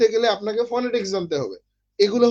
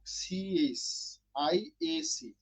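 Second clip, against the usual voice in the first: অক্ষর একে একে খুব ধীরে আর নরম করে বলা; প্রতিটা অক্ষর আলাদা করে ধীরে বানান করুন; 4 semitones lower, 12 dB quieter, much slower than usual. Shown as a demonstration. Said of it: "বানানটা একটু দেখতে হবে". (ধীরে ধীরে নরম স্বরে বানান)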